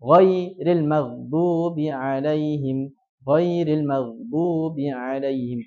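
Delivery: Ghairil maghdubi alaihim recited slowly, l flowing; recitation demo. Shown as a demonstration. Teacher recites the verse slowly for students to copy. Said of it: "Tapi dialirkan".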